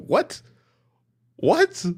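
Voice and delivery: high, rising disbelief; baffled, sharp rise on what; incredulous what. Real, not acted. Incredulous at quote.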